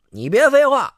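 loud high snap; angry snap. Acted. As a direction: cut him off hard. Rogue snaps at victim.

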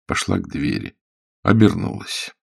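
ordinary delivery

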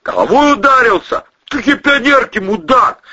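loud, high, incredulous scolding; exasperated high-pitched insult; angry retort. A narrator's reading. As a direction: tear into a stupid idea. Acted angry retort in story.

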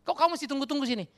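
loud, high, impatient challenge; acting out a forceful dismissal; sharp challenge. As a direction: challenge them to leave. Acting out Jesus challenging his followers.

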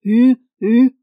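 loud, high call to a horse; shouted horse-driving call; ploughman's cry. A narrator's reading. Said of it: "j'entends le cri".

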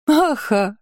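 laugh. Acted. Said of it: "рассмеялась она".

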